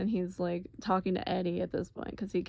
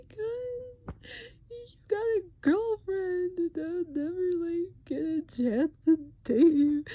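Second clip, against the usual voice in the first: mock crying; 11 semitones higher, 3 dB louder than usual. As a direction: blubber it like a drugged crybaby. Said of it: "you start crying".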